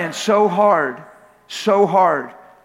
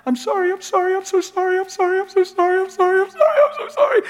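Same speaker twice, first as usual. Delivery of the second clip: high, pleading, sobbing imitation of his wife; imitating crying wife; 13 semitones higher than usual. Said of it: "Guess what she's saying repetitively?".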